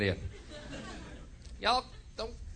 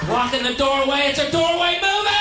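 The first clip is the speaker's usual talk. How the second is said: much higher, louder, squawking put-on yell; shrill mocking shout; 9 dB louder than usual. Comedian mocking tourists at a doorway, shrill mock shout.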